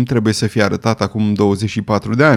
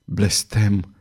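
softer, slower falling curse; repeated curse; 3 semitones lower, 5 dB quieter than usual. A narrator's reading. Queen repeats her curse to the astrologer, subdued. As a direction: let the repeated curse sink low in volume. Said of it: "repetă regina".

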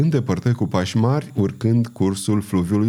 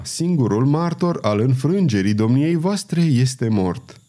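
ordinary delivery, nothing marked

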